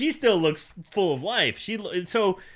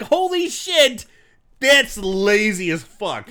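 slow disbelief; slower than usual. Retold or imitated. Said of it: "I'm like".

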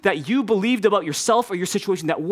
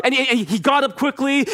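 usual speaking voice

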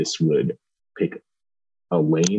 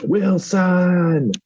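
high, drawn-out movie-quote yell; mock scream, stretched out; imitated scream; 13 semitones higher, 4 dB louder than usual. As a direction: cry it out long and high, imitating a screaming fan. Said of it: "as often as somebody screams".

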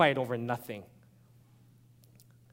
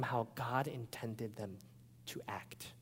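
whisper; 6 semitones lower, 14 dB quieter, slower than usual. Real, not acted. Preacher drops to a whisper.

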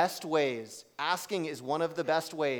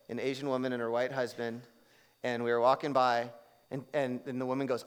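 usual speaking voice

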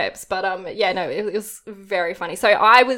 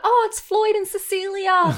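cooing townsfolk voice; 9 semitones higher than usual. Retold or imitated. Townspeople voiced greeting the child.

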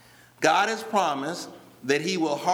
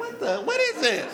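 amused, higher what is this; laughing disbelief; 5 semitones higher than usual. Retold or imitated. Preacher retelling his confusion at shouting church, laughing. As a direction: laugh through the question in bafflement.

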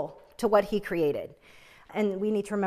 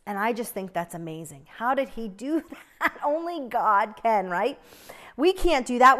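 ordinary talk, nothing marked

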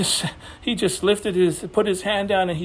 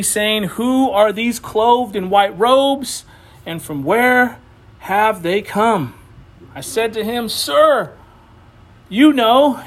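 scripture reading; 3 semitones higher than usual. Real, not acted. Preacher reading the elder's exchange to the church, solemn scripture reading. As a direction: read the verse out to the congregation, emphatically.

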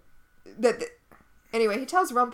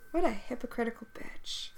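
muttered aside; 9 dB quieter than usual. Real, not acted. Muttered aside after sitcom outrage.

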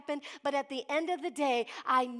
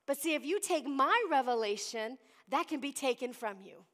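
ordinary delivery

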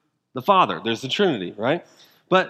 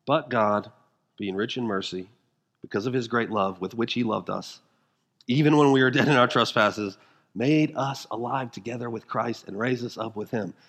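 lower, softer reassurance after the shouting; hushed gospel comfort; tender grace; 6 semitones lower, 3 dB quieter than usual. Acted. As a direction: drop down gently to speak of mercy.

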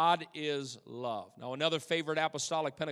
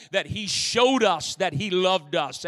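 ordinary talk

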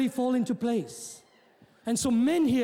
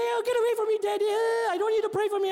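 mock kids' whine; 6 semitones higher than usual. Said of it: "the kids will say".

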